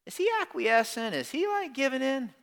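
high-pitched mock worried questioning; skeptical listeners. Preacher voicing what some of you are thinking, high mock doubt. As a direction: squeak it up high like a doubting churchgoer. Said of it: "Some of you are like".

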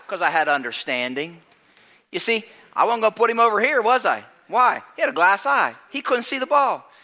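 ordinary delivery

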